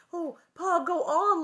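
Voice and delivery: put-on high, loud pleading child; eager cry. A narrator's reading. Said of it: "Laura cried".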